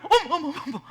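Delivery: loud, high flustered "어머"; woman jolted by the man's blunt greeting; startled gasps. Retold or imitated. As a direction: mimic a startled woman's surprise.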